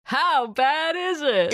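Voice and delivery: high, slow, drawn-out showman prompt; call-and-response cue. Pretend. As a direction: egg the joke on like a host. Cueing the punchline, game-show style.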